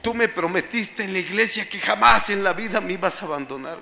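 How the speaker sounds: ordinary talk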